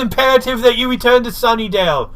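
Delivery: high, loud snooty Giles impression; mock Giles. Host mimics Giles gloating to Willow, high and loud. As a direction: lay it on thick as the pompous mentor. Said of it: "And he's like".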